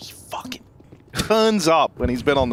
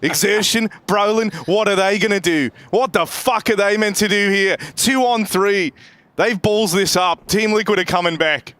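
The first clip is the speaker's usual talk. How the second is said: lightly raised excited casting; play-by-play call; 4 semitones higher than usual. Acted. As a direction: keep the round's tension in the call.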